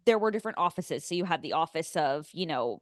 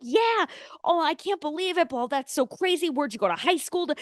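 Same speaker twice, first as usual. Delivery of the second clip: much higher, giddy imitation of the waiter; gushing waiter; 7 semitones higher than usual. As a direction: gush like a thrilled stranger. Waiter gushes over shared hometown.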